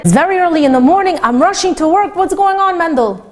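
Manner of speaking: rushing husband pressing for an answer; exasperated husband. Retold or imitated.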